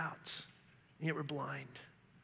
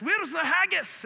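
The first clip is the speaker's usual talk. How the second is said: put-on Scottish accent, higher and louder; 8 semitones higher, 7 dB louder, slower than usual. Tourist trying his Scottish accent in Mexico, hammed-up Scottish. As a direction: draw it out in a loud, high mock-Scottish voice. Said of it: "the Scottish accent and just asked".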